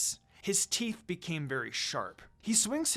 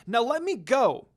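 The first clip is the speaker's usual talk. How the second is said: put-on struggling girl; 6 semitones higher, 7 dB louder than usual. A narrator's reading. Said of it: "as she kept struggling".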